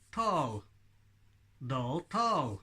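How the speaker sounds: "Doll, tall" said with lips kept still; "tall" replacing "Paul" with a tongue t; ventriloquist demo. Shown as a demonstration. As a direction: try the words without lip movement. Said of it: "Does it work?".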